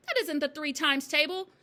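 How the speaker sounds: high, loud protest; crying out. A narrator's reading. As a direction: object loudly to the headmistress. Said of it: "Wilford cried".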